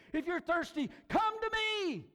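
high, ringing call; Jesus's yelled invitation. Acted. Preacher repeating Jesus's invitation to the thirsty, calling out. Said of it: "he yelled, he said".

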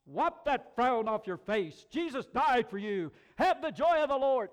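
loud, high, overbearing exhortation; mock preachy scolding. Tactless believer giving mourners pat answers, mock shout.

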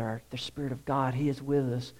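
usual speaking voice

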